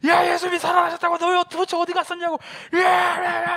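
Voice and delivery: high, loud, rapid frenzy; crazed yelling. Acted. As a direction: shriek the news like a madman. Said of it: "막 미친 사람 막 침 흘리면서".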